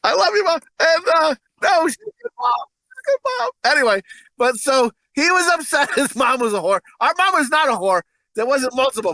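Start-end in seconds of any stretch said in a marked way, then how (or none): 0.0-3.5 high, giggly reassurance; laughing. Real, not acted. Laughing reassurance to his mom watching. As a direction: keep laughing while making amends.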